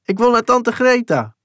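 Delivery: put-on high, loud desperate child's call; child crying out. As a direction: wail it up high like a frightened boy. Kind roept om zijn tante. (hoog wanhopig roepend kind)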